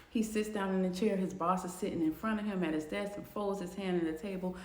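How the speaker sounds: normal talking voice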